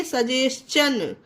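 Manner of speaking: slow सजेशन, "sa-jes-chun"; ch sound for T. Shown as a demonstration. Teacher answering her own question, slow सजेशन with "ch". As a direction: stretch सजेशन out, ending in "chun". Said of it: "तो क्या पढ़ेंगे".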